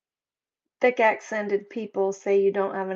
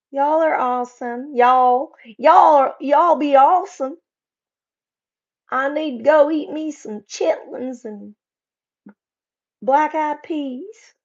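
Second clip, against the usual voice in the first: loud, pitched-up Southern twang; exaggerated Southern accent; 4 semitones higher, 7 dB louder than usual. Streamer praising her chat, hammed-up Southern accent.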